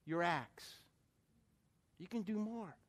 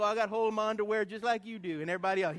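joking retort; 3 semitones higher, 7 dB louder, faster than usual. Retold or imitated.

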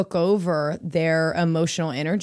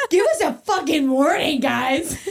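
exasperated plea; 8 semitones higher than usual. Retold or imitated. Exasperated mock plea about scary pics.

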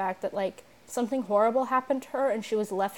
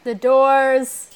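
loud, higher echoing exclamation; exclaiming; 4 semitones higher, 13 dB louder than usual. Real, not acted. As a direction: echo the gushing, loud and drawn-out. Echoing the gushing over doors.

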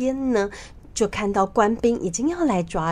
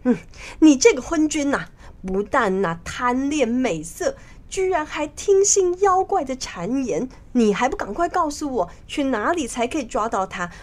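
indignant telling-off, surging higher on the accusations; scolding; 5 semitones higher than usual. Acted. Monkey King scolding the foolish king for trusting a demon, indignant. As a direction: berate him with raised, emphatic voice. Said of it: "骂道".

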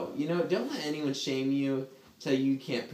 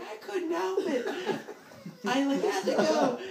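put-on much higher, laughing whiny kid voice; pleading child. Boy pleads with angry dad, high laughing whine. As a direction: beg like a caught ten-year-old. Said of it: "I'm, like, yelling".

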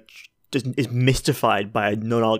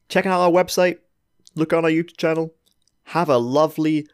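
mock announcer; 5 semitones higher than usual. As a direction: sell it like a cheesy host.

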